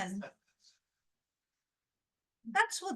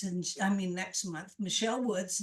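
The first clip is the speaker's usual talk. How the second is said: ordinary delivery, nothing marked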